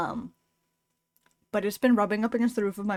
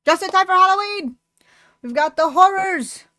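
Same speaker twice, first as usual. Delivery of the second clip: much higher and louder, hammed-up glee; gleeful cheer; 10 semitones higher, 11 dB louder than usual. Real, not acted. To chat, announcing the horrors episode, loud gleeful cheer. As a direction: shout it like a spooky-season hype host. Repeats the Halloween line, bigger.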